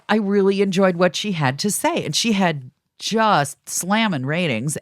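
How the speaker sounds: ordinary talk, nothing marked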